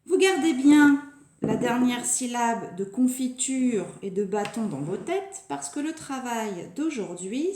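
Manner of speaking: ordinary delivery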